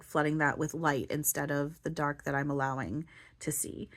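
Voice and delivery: ordinary delivery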